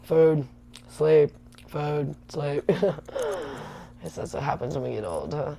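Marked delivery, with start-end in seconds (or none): 0.0-2.7 lazy cat voice. Retold or imitated. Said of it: "and now he's like". 2.7-3.8 a quick amused laugh; short laugh. Real, not acted. Laughing at own cat imitation.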